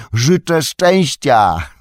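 slow, high, loud parting wish; long-drawn high holler; shouted farewell. A narrator's reading. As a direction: draw it out as a far-carrying call.

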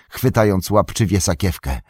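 plain reading voice

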